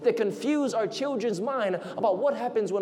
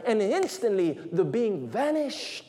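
usual speaking voice

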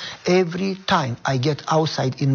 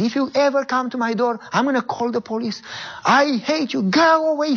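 high mock yell, rising to the end; retold screaming; 9 semitones higher than usual. Neighbor threatening police at his door, high mock shout. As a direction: throw him off the doorstep, high and hateful. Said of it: "screaming, calling me names".

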